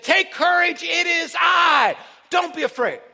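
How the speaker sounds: loud, high call of reassurance; Jesus. Acted. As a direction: call out boldly, drawing out the words. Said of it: "immediately Jesus spoke".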